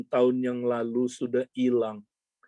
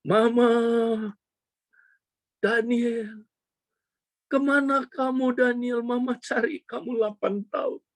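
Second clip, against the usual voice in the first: mock-tearful, pitched way up; acted emotional reunion; 10 semitones higher than usual. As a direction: imitate an overwhelmed parent finding a lost child. Acted tearful reunion of mother, son.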